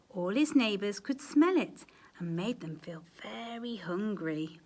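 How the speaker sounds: ordinary delivery, nothing marked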